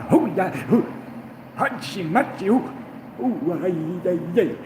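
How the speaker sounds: high goofy hooting outburst; Dom DeLuise tick. Retold or imitated. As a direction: let out silly high-pitched whoops. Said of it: "subtle but increasing tick".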